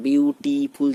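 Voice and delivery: Beautiful split into three loud, separate beats; syllable by syllable. Shown as a demonstration. Teacher contrasting beautiful with one-beat strong, said syllable by syllable. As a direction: break up beautiful as বিউ-টি-ফুল. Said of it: "যেভাবে বলছি".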